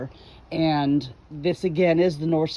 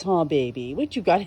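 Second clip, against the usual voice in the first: usual speaking voice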